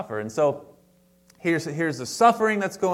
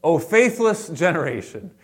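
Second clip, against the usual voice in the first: stern rebuke; 4 semitones higher, 6 dB louder than usual. Retold or imitated. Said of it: "He says".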